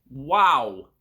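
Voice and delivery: loud amazed wow. Real, not acted. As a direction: blurt it out in amazement. Reacting to the texture.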